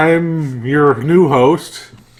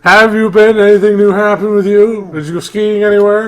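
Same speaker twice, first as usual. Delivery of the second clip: higher, louder chirpy host voice; talk show host; 7 semitones higher, 5 dB louder than usual. Pretend. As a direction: play the upbeat interviewer.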